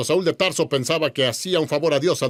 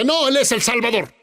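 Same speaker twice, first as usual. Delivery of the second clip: retold yell; 7 semitones higher than usual. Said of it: "Le grité a la televisión".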